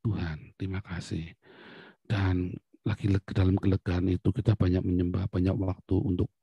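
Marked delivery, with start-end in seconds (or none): 0.0-1.3 softer, hushed thanks to God; quiet grateful prayer. Acted. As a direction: murmur it like a humble prayer. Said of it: "kita uji sama Tuhan".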